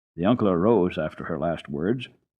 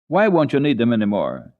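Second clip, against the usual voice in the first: asked aloud; 4 semitones higher, 7 dB louder than usual. A narrator's reading. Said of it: "he asked aloud".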